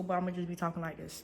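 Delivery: talking with a mouth full of food; chewing between the words. Real, not acted. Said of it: "I know it's rude to talk with your mouth full".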